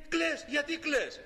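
put-on slow, high, gentle child's voice; tender comfort. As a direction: soothe a crying friend, slowly. Prince comforts the crying child. (αργά, τρυφερά, παιδική φωνή που παρηγορεί)

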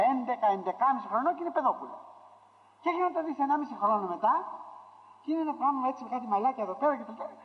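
usual speaking voice

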